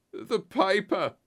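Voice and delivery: high, slow croak; croaked cry. A narrator's reading. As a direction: croak it out slowly in a high, strained voice. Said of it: "croaked a voice behind us".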